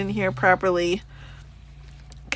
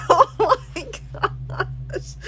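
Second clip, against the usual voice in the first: laughing; 8 semitones higher, much slower than usual. Real, not acted. Cracks up at the burn book.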